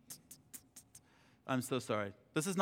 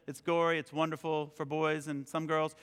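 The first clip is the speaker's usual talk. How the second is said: usual speaking voice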